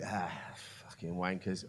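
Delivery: low, very soft grumbled insult; muttered disgust. Acted. Hearer reacting to a stag-do accent, muttered disgust. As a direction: say it under your breath, dropped right down.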